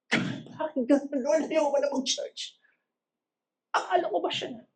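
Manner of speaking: high, soft wail to God; mock heartbroken complaint. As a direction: whimper a hammed-up protest, rising in pitch. Acted complaint to God after heartbreak. (kunwaring paiyak na reklamo sa Diyos, mataas at mahina)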